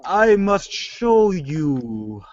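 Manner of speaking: slow, drawn-out evil villain; unhinged villain. Acted. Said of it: "get more evil".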